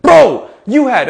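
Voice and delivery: loud, high, drawn-out exclamation; hammed-up stunned outburst; mock amazement. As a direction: burst out in playful disbelief. Jokey amazement at child's dinner.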